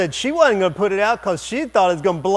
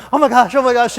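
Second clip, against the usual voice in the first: high, loud, rapid-fire fretting; mock panic; 7 semitones higher, 9 dB louder, faster than usual. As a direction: imitate a panicking girl. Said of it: "they are screaming and jumping up and down".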